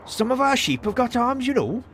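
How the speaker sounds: high-pitched, hammed-up Welsh voice; exaggerated Welsh impression; Welsh accent. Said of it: "mood to do a Welsh voice".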